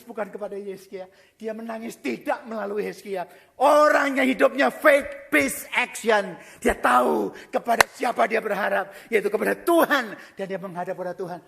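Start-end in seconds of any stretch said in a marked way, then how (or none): 3.6-10.2 loud, rising sermon shout; fiery preaching. Acted. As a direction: preach it at full force to the congregation. Preaching at full force.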